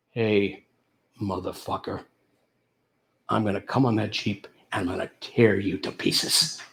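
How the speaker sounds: low, soft, deliberate threat; imagined predator talking, low and unhurried; menacing lion. Acted. Lion eyeing the man in the Jeep, low quiet menace. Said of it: "the look in his face is like".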